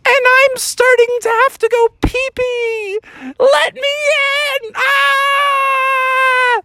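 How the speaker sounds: desperate high squeal of Junior Bear; woeful screaming. Acted. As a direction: shriek like a cub needing the toilet. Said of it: "screaming woefully".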